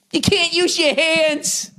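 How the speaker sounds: high, drawn-out mocking cry; mocking yelp. Comedian ridiculing soccer to listeners, high mocking yelp.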